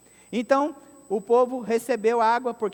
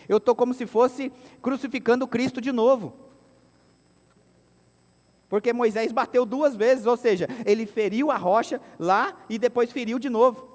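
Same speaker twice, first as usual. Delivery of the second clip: louder, fervent sermon climax; preaching at full force; 2 semitones higher, 4 dB louder than usual. Acted. Pastor driving home the new covenant, loud preaching. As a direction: proclaim it to the whole church.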